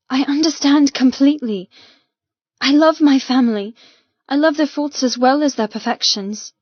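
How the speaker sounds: earnest confession. Acted. Kite's heartfelt text reply.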